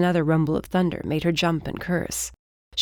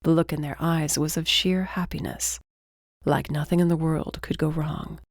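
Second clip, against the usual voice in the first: usual speaking voice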